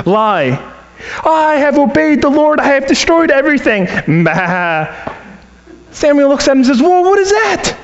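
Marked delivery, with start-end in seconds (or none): 1.2-3.9 higher, overly sure of himself; a hint of a smug king; mock Saul. Saul's lying boast to Samuel. 4.2-4.9 a quick little sheep baa; lightly voiced sheep noise; sheep bleat. 6.8-7.7 much higher, pointed question; a hint of an accusing prophet; mock surprise. Samuel catches Saul out.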